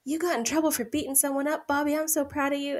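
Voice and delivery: high, beaming proud-father voice; proud dad. Retold or imitated. Retelling Hank praising Bobby, proud dad voice. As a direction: gush over the son like a proud dad. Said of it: "Hank's all proud 'cause he's like".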